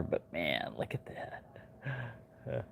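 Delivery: breathy near-whisper of awe; hushed aside. Real, not acted. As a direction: murmur it to yourself, impressed. Admiring the knife quietly.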